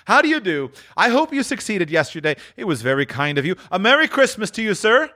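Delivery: high, eager greeting; Scrooge, effusive. Acted. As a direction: greet him with high-pitched cordiality. Scrooge greets the old gentleman.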